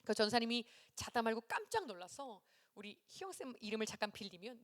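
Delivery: usual speaking voice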